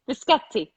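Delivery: a not quite native "Biscotti"; attempted pronunciation. Shown as a demonstration. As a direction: repeat "Biscotti" carefully after the Italian model. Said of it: "So pathetic".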